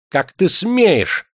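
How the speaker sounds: very high, loud, drawn-out outrage; frantic shriek. A narrator's reading. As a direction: squeal in indignation. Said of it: "истошно взвизгнул проезжий". (истошный возмущённый визг)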